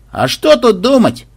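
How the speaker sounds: high, slow, assertive soldier voice; soldier piping up. A narrator's reading. One soldier unexpectedly joining the discussion, piping up. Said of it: "неожиданно подал голос один из солдат".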